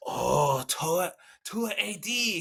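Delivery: put-on high, drawn-out admiring Japanese accent; mock Japanese accent. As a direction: savour the club name as a Japanese golfer. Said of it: "Japanese executives".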